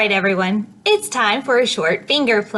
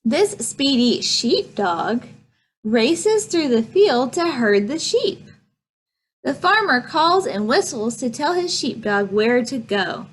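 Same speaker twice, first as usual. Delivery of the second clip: normal talking voice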